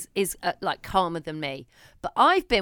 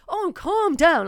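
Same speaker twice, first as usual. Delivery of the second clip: mocking calm down; 8 semitones higher, 7 dB louder, slower than usual. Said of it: "don't say".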